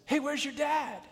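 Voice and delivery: put-on high, bright kid voice calling across the field; kid teammate calling. Teammate at second base asks the boy about his dad, high child voice. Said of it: "your friend runs over and says".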